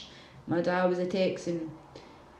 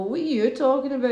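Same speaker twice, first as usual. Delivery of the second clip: higher, louder, quick challenge; scoffing pitched-up comeback; incredulous retort; 6 semitones higher, 5 dB louder, faster than usual. Acted. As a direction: fire it back fast, disbelieving. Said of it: "we just wanna go".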